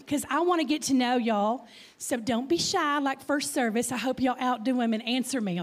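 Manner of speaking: ordinary delivery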